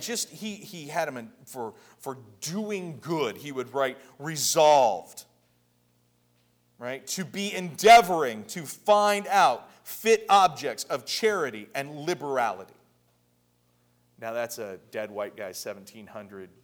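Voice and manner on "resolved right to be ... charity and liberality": loud, emphatic reading of a solemn resolution; declaimed quotation. Acted. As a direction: proclaim it phrase by phrase.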